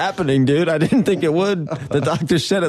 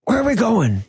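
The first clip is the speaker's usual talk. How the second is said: high, excited mad scientist; Doc Brown voice; 8 semitones higher than usual. Retold or imitated. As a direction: play the frantic old inventor. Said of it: "trying out for Doc in Back to the Future".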